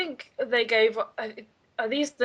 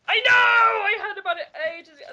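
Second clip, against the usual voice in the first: high, loud giddy exclaiming; excited laughing shout; 11 semitones higher, 5 dB louder, faster than usual. Real, not acted. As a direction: squeal the news with laughter. Excited about band news.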